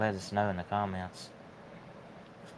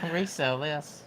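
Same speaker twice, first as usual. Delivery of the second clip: mock baby wail, then a higher demand; hammed-up infant crying for a resale; playful crybaby voice; pretend baby; 5 semitones higher than usual. Imagines newborn at yard sale.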